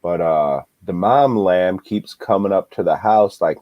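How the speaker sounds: ordinary talk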